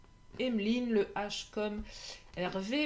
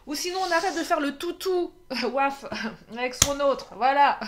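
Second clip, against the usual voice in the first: laughing; 4 semitones higher, 7 dB louder than usual. Real, not acted. Laughs while joking about the dog.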